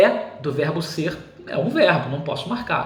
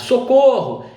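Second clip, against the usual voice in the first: exclamation; 5 semitones higher, 6 dB louder, slower than usual. Pretend. Reading an example exclamatory sentence.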